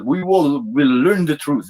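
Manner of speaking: slow, firm threat; stern general. Retold or imitated. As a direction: press the words slowly as a stern officer. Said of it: "And he says".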